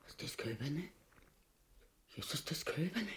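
low, hushed question; barely voiced, dismayed echo; aghast disbelief. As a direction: ask it very softly, stunned. Learns the veal was eaten.

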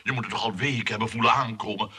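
ordinary talk, nothing marked

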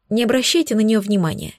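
ordinary delivery, nothing marked

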